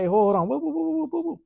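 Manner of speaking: sharp, high, loud whoas; calling halt. Retold or imitated. Husband halting his wife mid-argument, high loud whoa calls. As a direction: stop her mid-argument with rising whoas. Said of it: "He's like".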